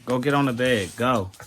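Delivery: loud firm command; ordering the dog. Real, not acted. Ordering dog onto the bed.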